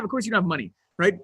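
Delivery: normal talking voice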